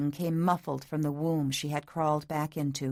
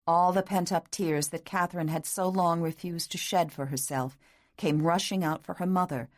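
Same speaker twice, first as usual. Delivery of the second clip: ordinary delivery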